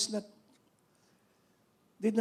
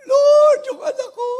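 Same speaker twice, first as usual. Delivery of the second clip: much higher, louder wail; crying out; 17 dB louder than usual. Acted. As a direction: cry out for the child. Mother's cry voiced. (umiiyak na pagtawag)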